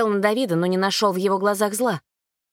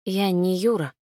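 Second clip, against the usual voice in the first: trembling voice. A narrator's reading. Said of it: "дрожащим голосом произнёс парнишка".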